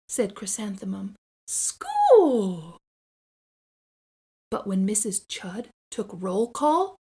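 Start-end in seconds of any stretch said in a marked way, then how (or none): 1.5-2.8 high, loud happy shout; excited cry. Acted. Little girl shouting gleefully on her way to school. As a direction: burst out with joy. Child cheering about school.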